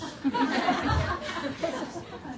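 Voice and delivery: high, breathy burst of laughter. Real, not acted. Pastor laughing at his wife's fifty pesos, high laugh. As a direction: laugh out at the tiny sum.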